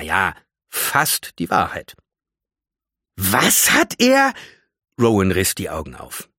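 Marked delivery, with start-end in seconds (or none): 3.2-4.3 high, loud, stretched-out astonishment; shocked disbelief. Acted. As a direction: draw it out, aghast and high. Shocked at the revelation. (entsetzt, hoch und gedehnt)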